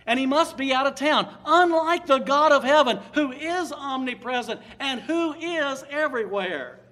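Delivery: loud, emphatic pulpit proclamation; preaching at full force. Acted. Preacher extolling God's power to the congregation, booming. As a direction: proclaim it to the back row.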